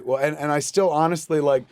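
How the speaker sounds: normal talking voice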